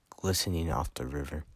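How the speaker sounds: plain reading voice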